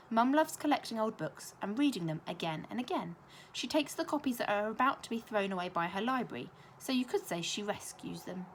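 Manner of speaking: ordinary delivery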